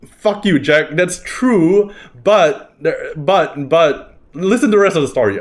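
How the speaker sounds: high, excited mock outrage at a friend; laughing protest. Real, not acted. Listener teases, speaker protests.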